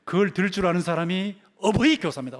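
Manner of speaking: usual speaking voice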